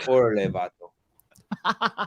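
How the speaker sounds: slow, drawn-out Spanish slang; Mexican Spanish accent. Shown as a demonstration. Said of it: "Spanish accent".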